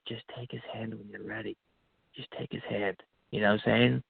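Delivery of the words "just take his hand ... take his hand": hushed inner voice. Retold or imitated. Inner voice guiding him.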